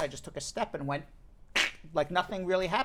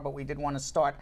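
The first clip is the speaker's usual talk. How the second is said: usual speaking voice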